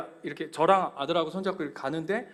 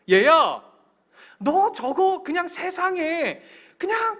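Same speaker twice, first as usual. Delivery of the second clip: high, loud fervent sermon; preaching at full force; 10 semitones higher, 8 dB louder than usual. Acted. Pastor urging the congregation to follow God. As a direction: drive the point home to the congregation.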